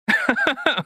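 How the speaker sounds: high burst of laughter. Real, not acted. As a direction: laugh out at your own joke. Laughs at own joke.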